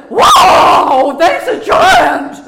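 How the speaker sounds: loud, high, slow cry of alarm; hammed-up shocked yell; startled shout. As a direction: shout it at the sight of a giant. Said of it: "You know, it's a".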